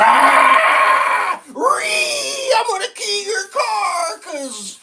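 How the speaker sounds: high, screeching mock tantrum; mock raging idiot. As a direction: squeal it like a whiny, raging fool. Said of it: "this low I Q individual was like".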